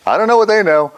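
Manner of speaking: high playful emphasis. Real, not acted. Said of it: "playful high emphatic line".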